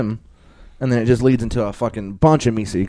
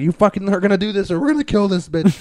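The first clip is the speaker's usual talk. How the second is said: high-pitched mock threat; threatening; 9 semitones higher than usual. Retelling Meeseeks at the restaurant with guns, mock threatening. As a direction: imitate a cartoon character making threats, pitch raised. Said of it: "like, threatening".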